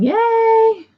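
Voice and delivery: high, drawn-out yay; little squeal of celebration; excited cheer. Real, not acted. Cheers that tea is ready.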